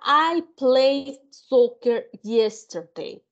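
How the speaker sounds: "I played soccer yesterday" read slowly; slow reading aloud. Shown as a demonstration. Teacher reading the first example sentence to students slowly. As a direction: spell out each word for the class. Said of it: "Dice la primera".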